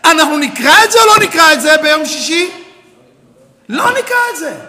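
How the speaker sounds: loud, high question then answer; rhetorical challenge. Real, not acted. Rabbi challenging his students, loud rhetorical question. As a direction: press the crowd.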